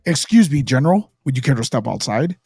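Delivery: low, commanding hero voice; Superman. Retold or imitated. Superman challenging General Zod to a fight, low heroic voice. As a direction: drop low for a polite challenge. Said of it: "he's gonna fight General Zod, he's like".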